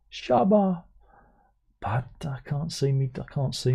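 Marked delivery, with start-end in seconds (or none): 0.1-0.8 high, punchy dancehall MC call; dancehall shout. Retold or imitated. Joking about making dancehall music, mock dancehall shout.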